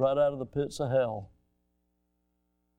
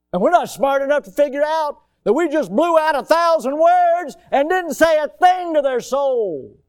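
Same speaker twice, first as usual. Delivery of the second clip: high, loud, pounding sermon climax; fiery preaching; 11 semitones higher, 8 dB louder than usual. Real, not acted. Pastor preaching loud to parents. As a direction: preach it hard to the congregation.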